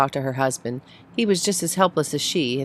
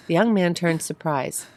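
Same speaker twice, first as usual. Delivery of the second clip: plain reading voice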